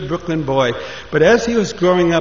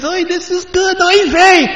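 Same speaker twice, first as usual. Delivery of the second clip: very high, rising to louder; thrilled rider; 5 dB louder than usual. Acted. Moshe urges the horse on, very high and excited. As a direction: whoop with joy as it speeds up.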